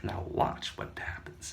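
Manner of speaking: slow sly whisper. Acted. Doctor before his experiment, whispering slowly.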